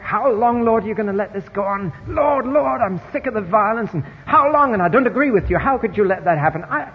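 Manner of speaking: high, pleading complaint; complaining prophet. Retold or imitated. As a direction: cry out to God, exasperated. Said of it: "here's Habakkuk".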